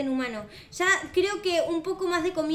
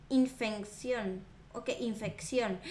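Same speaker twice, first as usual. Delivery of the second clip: ominous whisper-soft; 6 semitones lower, 6 dB quieter, slower than usual. Pretend. Reads ominous diary word.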